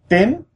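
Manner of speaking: Tím pitched high and climbing; rising tone. Shown as a demonstration. Repeating purple for learners, Tím in a high rising tone. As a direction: lift Tím sharply upward. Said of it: "a rising tone".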